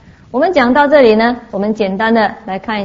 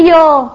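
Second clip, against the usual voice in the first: louder, higher "yo" of pain; mock cry of pain; 5 semitones higher, 7 dB louder than usual. Mimicking beings in hell who can only groan, mock pained cry.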